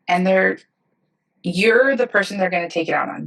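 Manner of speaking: ordinary talk, nothing marked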